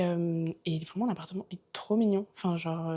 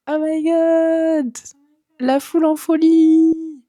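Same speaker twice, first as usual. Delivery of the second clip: excited shout; 9 semitones higher, 11 dB louder, much slower than usual. Pretend. Mock crowd excitement.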